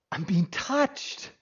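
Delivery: slow, hushed wonder; awed whisper-soft. Retold or imitated. Leper feeling a touch after years, slow and hushed. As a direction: let it come out slowly in quiet amazement.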